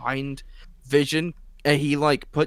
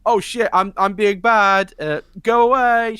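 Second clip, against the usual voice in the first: higher, louder mock panic; mock panicked Wanda; 6 semitones higher, 6 dB louder than usual. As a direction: play a flustered witch shooing people off. Mocking Wanda losing control.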